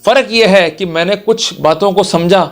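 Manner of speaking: ordinary talk, nothing marked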